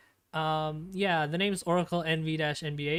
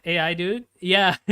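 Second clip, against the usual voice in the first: excited laugh; 2 semitones higher, 9 dB louder than usual. Real, not acted. Thrilled at showing new model.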